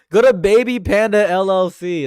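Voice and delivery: loud, high sponsor plug; hype shout. Acted.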